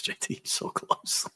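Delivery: whispered regret. Pretend. Mock disappointment near miss.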